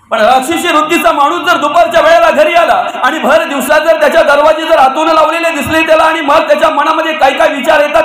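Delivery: normal talking voice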